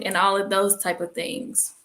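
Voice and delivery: ordinary talk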